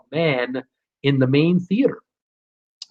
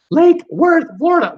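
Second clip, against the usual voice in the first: Lake Worth said much higher and louder; proud clean retake; 12 semitones higher, 5 dB louder than usual. Shown as a demonstration. After fumbling the viewer's town, high triumphant retake. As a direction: land the name triumphantly, high and loud. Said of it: "I'll, I'll get it out".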